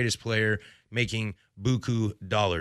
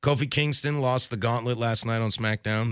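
ordinary talk

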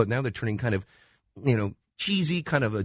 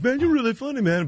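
높은 목소리로 스티브 페리 흉내; 13 semitones higher than usual. Retold or imitated. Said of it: "And he goes".

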